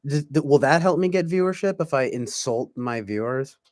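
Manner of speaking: usual speaking voice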